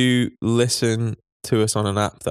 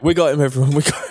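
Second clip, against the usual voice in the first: mock triumph; 6 semitones higher, 4 dB louder, faster than usual. Jokingly celebrating a caught admission.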